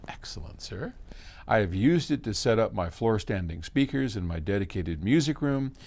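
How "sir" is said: normal talking voice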